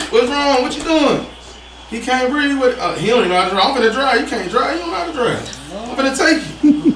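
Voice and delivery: high-pitched, agitated retold bickering; acted-out family panic. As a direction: play the flustered relatives squabbling, voice pitched high. Acts out panicked family quarrel.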